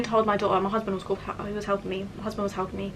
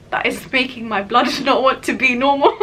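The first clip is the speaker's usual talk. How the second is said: laughing through the complaint; half-laughing, half-exasperated; laughing mid-rant; 4 semitones higher, 7 dB louder than usual. Retold or imitated. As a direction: break into laughs while venting. Retelling angry tearful plea, laughing.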